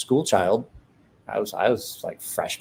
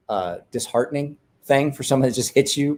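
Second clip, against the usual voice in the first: ordinary talk, nothing marked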